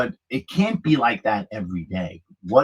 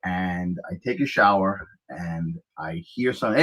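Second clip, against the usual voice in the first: normal talking voice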